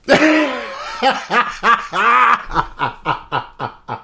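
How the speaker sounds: long helpless laughing fit; real belly laugh. Real, not acted. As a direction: crack up at your own joke. Cracks up at own bit.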